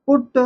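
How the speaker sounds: "पुट" with a short, jerked u. Shown as a demonstration. Teacher showing the small u sign, "पुट" quick and jerky. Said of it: "छोटा ऊ की मात्रा".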